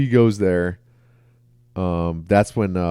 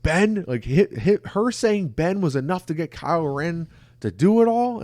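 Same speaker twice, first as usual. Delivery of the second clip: high, indignant mock disbelief; incredulous outrage; 9 semitones higher than usual. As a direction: imitate an indignant podcaster questioning it. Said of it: "they got really".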